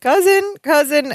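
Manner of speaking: high plaintive call of cousin; lightly voiced Balki calling for his cousin.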